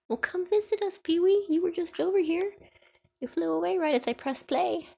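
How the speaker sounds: high, loud coaxing call; high-pitched pet talk. Real, not acted. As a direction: call the bird over, bright and high. Calling to the bird.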